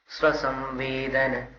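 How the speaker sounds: स्वसंवेदन and सुव्यक्तस said slowly as two separate words; slow, each word apart with a pause between; split into parts. Shown as a demonstration. Teacher breaking the verse apart for students, slow and separated. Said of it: "स्वसंवेदन को अलग कर दिया".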